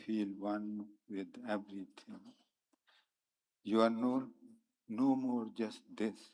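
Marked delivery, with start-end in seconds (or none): none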